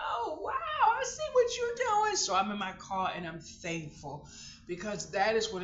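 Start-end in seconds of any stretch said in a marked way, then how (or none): 0.1-2.2 high, bright burst of amazed delight; a much higher, louder gasp of joy; delighted wonder. Retold or imitated. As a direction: marvel out loud, pitch soaring. Delighted exclamation to God.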